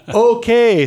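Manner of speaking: high, stretched embarrassed okay; high awkward drawn-out okay. Retold or imitated. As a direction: back off sheepishly. Awkward reaction retold.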